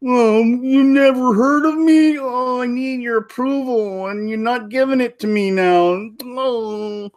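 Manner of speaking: high, slow, mocking needy whine. Star-Lord begging the villain for approval, mock whiny. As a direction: beg for approval in a sing-song whine. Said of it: "self-defeating like that".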